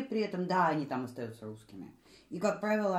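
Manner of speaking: ordinary talk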